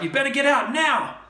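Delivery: loud, high call into the ship; shout. A narrator's reading. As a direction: shout a warning into the wreck. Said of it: "I shout".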